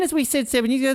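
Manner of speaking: usual speaking voice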